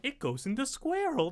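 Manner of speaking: quoting a singsong line softly; hushed imitation of a familiar inflection; imitated video inflection. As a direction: softly mimic the lilt on "square hole". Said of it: "Spooky even did the same, like, um, inflection".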